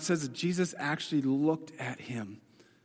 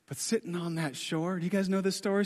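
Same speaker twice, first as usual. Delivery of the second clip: ordinary delivery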